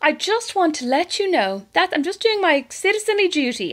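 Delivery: high prim phone voice; lightly hammed civic duty; mock dutiful citizen. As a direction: sound very proper and dutiful. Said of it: "I'd just be like".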